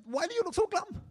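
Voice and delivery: very high, baffled question; exasperated friend. Acted. Friend not able to understand his sadness, exasperated. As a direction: voice shooting up in acted exasperation. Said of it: "was really berserk with".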